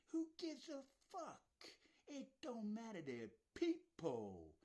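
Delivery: high, loud outburst that slows on They're people; angry shout. Real, not acted. Ranting at viewers about killed children, angry shout. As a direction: yell it out in real outrage.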